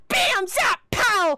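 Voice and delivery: high, punchy sound effects; comic battle noises. Acted. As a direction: act out each hit with a shout. Hero replays his fight moves.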